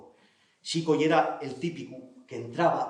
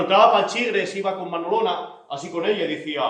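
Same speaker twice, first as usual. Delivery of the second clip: plain reading voice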